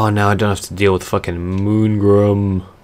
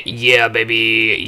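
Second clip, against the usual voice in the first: excited; 3 semitones higher, slower than usual. Real, not acted. Celebrating victory.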